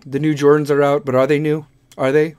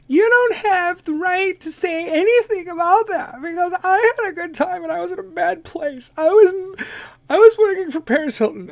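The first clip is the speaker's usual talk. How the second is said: Kim Kardashian voice; 11 semitones higher than usual. Pretend. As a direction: play a confused Kim, pitched way up. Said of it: "the Kardashian voices".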